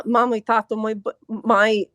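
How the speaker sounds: usual speaking voice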